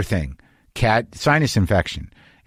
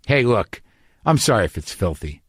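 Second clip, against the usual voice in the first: normal talking voice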